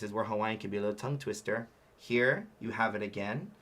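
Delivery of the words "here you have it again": ordinary talk, nothing marked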